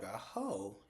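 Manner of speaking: high, drawn-out disbelief; mock-shocked squeak; Sabrina, incredulous. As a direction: stretch it slowly as if the question is absurd. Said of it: "Sabrina was like".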